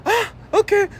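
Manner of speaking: put-on high, sweet toddler reply. Toddler understanding the instructions, high childlike voice. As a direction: answer like a little kid catching on. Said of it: "she's like".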